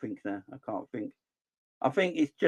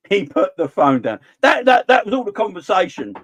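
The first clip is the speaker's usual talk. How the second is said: laughing; 6 semitones higher, 7 dB louder than usual. Real, not acted. Laughing punchline, loud.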